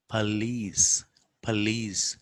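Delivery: police as "puh-LEECE"; weak start, long "lees" in "police"; schwa, not O. Shown as a demonstration. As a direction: say "police" with a tiny first vowel.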